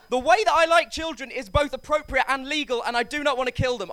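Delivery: high, loud protest; flustered over-insisting. Acted. Flustered self-defence digging a hole.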